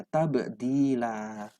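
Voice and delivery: "Tabdila" slow, the b bounced with a small echo; qalqalah bounce. Shown as a demonstration. Teacher shows students the qalqalah on "Tabdila". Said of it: "Ketemu qalqalah lagi ya".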